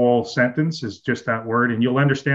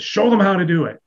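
bossy coach quote; 7 semitones higher, 4 dB louder than usual. Retold or imitated. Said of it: "he grabs my wife and he says".

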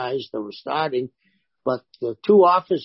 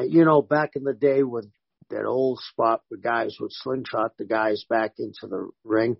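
usual speaking voice

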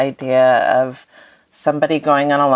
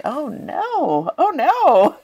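a mock groan of dismay, breaking into a laugh; amused mock alarm, laughing; much slower than usual. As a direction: laugh through a playful oh no. Mock dismay at moralising idea.

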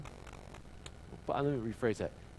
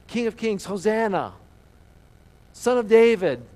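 crowd hailing; 5 semitones higher, 7 dB louder than usual. Acted. Acts the crowd hailing Jesus.

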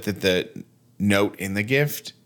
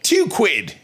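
high, exaggerated fake Australian; mock Australian; 10 semitones higher, 3 dB louder than usual. Co-host interrupting the flight explanation, high mock Australian. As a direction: butt in with a hammed-up Australian squawk. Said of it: "You don't sound Australian".

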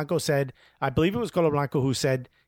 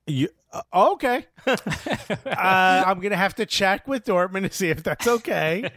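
mock agent; 4 semitones higher than usual. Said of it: "his agent was like".

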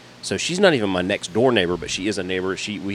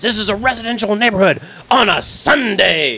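higher, louder mock yell of an angry neighbor; shrill neighbor yell; 11 semitones higher, 5 dB louder than usual. Mimicking the neighbor who yells about the noise, hammed-up shrill. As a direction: squeal it like an outraged neighbor. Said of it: "she continues to yell".

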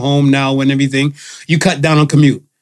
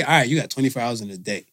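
usual speaking voice

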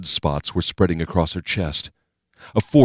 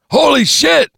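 very high, loud startled oath; startled high-pitched yell; shocked shout; 7 dB louder than usual. Acted. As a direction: burst out in alarm. Gunman startled by wanted man.

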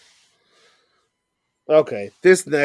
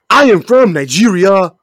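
higher, louder put-on Nigerian accent; mock Nigerian accent; 4 semitones higher, 6 dB louder than usual. Podcaster mocking a wrestler's gimmick, put-on Nigerian accent. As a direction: proclaim it in an exaggerated Nigerian accent. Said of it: "all of a sudden talk like this".

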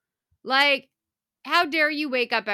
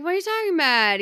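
higher and louder, incredulous; higher, louder voicing of Nick balking at the request; disbelief; 7 semitones higher, 6 dB louder, faster than usual. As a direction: react like the idea is absurd.

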